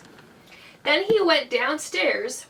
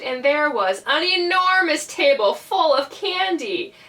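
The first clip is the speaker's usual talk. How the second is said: usual speaking voice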